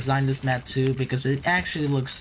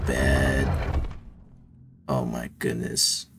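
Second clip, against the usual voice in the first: despairing groan; 13 semitones lower, much slower than usual. Real, not acted. Failing the jump again.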